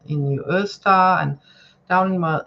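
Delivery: ordinary talk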